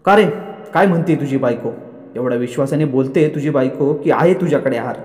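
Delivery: usual speaking voice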